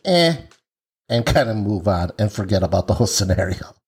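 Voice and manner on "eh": dismissive eh. Retold or imitated. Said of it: "the men are just like".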